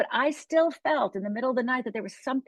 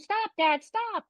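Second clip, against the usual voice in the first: mock scream, pitched up without volume; retold scream; 12 semitones higher, much slower than usual. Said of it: "I just screamed".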